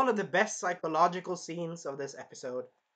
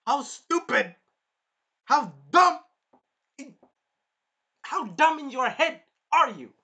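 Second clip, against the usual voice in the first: loud, high-pitched disbelief with hard punches; hammed-up scornful outburst; exasperated berating; 9 semitones higher, 9 dB louder than usual. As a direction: berate him, pausing between jabs.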